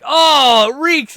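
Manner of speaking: high, loud cry of disgust; disgusted shout. Retold or imitated. Roommates shouting at him over the bucket. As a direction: recoil at the stench. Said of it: "they're like".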